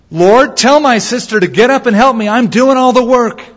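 the worker who is fed up and demands help; angry complaint. Retold or imitated. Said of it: "she gets mad and starts rebuking God".

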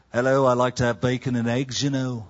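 badly faked English accent for a breakfast order; British accent. American tourist ordering breakfast in London, mock British accent. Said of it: "I ordered in my British accent".

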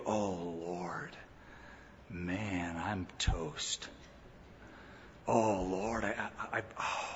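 a lost man realizing he's toast; despairing groan. Pretend. The convicted reader of the law, low hopeless moan fading out. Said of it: "should make you go".

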